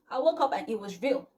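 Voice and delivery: usual speaking voice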